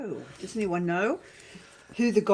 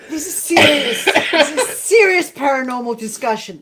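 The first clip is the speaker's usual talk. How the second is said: laughing; 7 dB louder than usual. Real, not acted. Trying to stop laughing.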